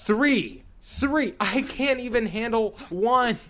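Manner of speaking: squeaky exasperation; exasperated high pitch. Real, not acted. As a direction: voice jumps high in disbelief. Exasperated outburst at game.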